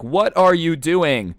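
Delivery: high, drawn-out indignant question; slow, exasperated retold yell; mock annoyed yell. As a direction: imitate an annoyed man yelling. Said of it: "the guy who's yelling".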